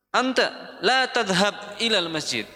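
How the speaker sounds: teacher forbidding a student to go to the mosque, commanding. Shown as a demonstration. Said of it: "Nyuruh kan, kamu jangan pergi".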